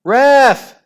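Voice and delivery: very high, very loud call; scream. A narrator's reading. Margaret appealing to the referee, screaming. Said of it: "screamed Margaret".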